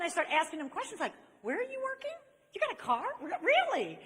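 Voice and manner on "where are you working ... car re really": high-pitched gushing interest; eager questioning. Retold or imitated. Woman suddenly asking her friend all kinds of questions, gushing. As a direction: fire questions in a hammed-up high voice.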